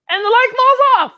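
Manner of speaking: a playful gasp of a punchline; dramatic. A narrator's reading. Storyteller hamming up the funny moment for kids.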